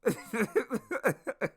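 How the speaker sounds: high burst of chuckling; real laugh. Real, not acted. Storyteller amused by the uniform mix-up, laughing. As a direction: crack up at your own story.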